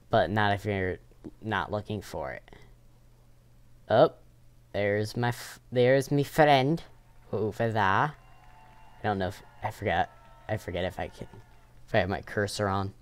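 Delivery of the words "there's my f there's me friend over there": ordinary talk, nothing marked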